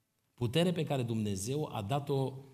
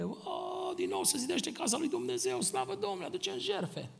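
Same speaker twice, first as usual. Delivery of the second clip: much higher, ringing cry of praise; joyful cry. Acted. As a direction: exult like returning exiles seeing the temple rebuilt. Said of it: "strigau de bucurie".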